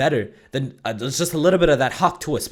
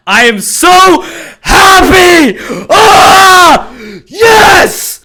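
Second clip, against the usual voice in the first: much higher, much louder ecstatic shout; joyful shout; 15 dB louder, much slower than usual. Acted. Fan screaming about the podcast, ecstatic shout. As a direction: scream it out in delight.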